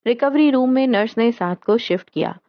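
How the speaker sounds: plain reading voice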